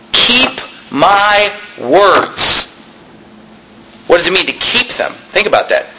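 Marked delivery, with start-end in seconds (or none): 0.1-2.7 slow solemn reading. Acted. Slow emphatic scripture reading.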